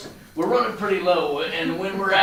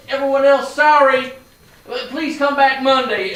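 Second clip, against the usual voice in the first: louder, announcing to the line; calling out to crowd; 4 semitones higher, 6 dB louder than usual. Acted. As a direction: call it out to everyone waiting.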